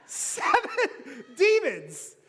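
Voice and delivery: Seven demons, slow, high, with a laugh; amused disbelief, slow; laughing stress. Shown as a demonstration.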